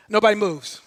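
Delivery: ordinary delivery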